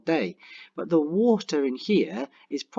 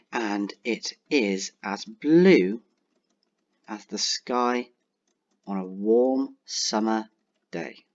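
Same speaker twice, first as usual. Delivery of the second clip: slow dictation; much slower than usual. Shown as a demonstration. Dictating simile while typing.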